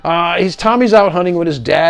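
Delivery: ordinary talk, nothing marked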